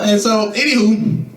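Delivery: ordinary talk, nothing marked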